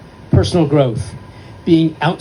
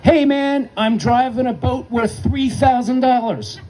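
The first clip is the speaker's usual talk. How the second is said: put-on high, laughing kid's exclamation at the helm; excited yell; 10 semitones higher than usual. Eighth grader at the wheel to his instructor, thrilled high shout. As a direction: shout it out like a thrilled boy steering a boat. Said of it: "he screams out".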